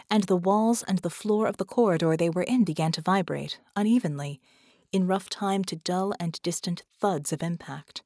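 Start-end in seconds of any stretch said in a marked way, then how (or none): none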